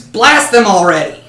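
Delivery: halfling screams at the dragon to blast them; screaming order. Acted. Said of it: "and screams".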